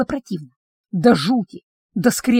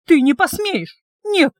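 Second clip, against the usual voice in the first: desperate protest; 7 semitones higher, slower than usual. Acted. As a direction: fight him off with your voice. Heroine struggling against vampire.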